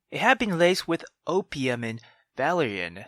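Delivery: ordinary talk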